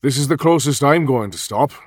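put-on bus driver with a sing-song Welsh accent; lightly lilting Welsh driver voice. A narrator's reading. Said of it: "in a lilting Welsh accent".

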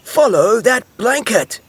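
high, loud, stretched-out command; urgent yell. A narrator's reading. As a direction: send everyone chasing. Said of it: "yelled Fox".

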